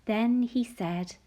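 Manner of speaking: ordinary delivery, nothing marked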